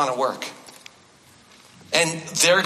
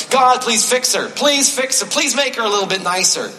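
higher and louder, a hammed-up plea; mock pleading prayer; 6 semitones higher, 3 dB louder than usual. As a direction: beg God half-jokingly to fix her. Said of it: "But if I did, hypothetically".

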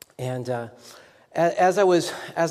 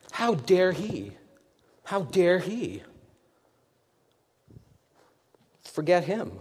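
mock offense; 3 semitones higher, slower than usual. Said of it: "mental pity party".

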